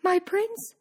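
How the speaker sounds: high, dismayed Cindy voice; shocked thought. A narrator's reading. Cindy's heart torn by her prince, high dismay. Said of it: "she thought".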